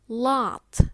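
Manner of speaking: American "lot" with an open "ah" vowel, loud; American accent. Shown as a demonstration. Teacher contrasting a tricky word, American accent. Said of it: "In American, it's pronounced".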